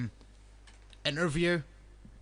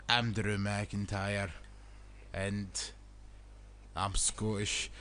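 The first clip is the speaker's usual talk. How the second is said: mock mumbled Scottish; 8 semitones lower, 6 dB quieter, slower than usual.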